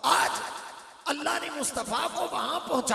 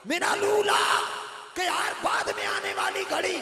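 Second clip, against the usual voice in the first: fervent preaching; 5 semitones higher than usual. Acted. Preacher swearing an oath to the scholars, fervent preaching. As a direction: proclaim it with rising fervour. Preacher back at full force.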